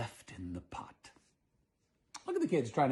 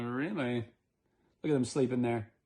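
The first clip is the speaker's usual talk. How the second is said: ordinary delivery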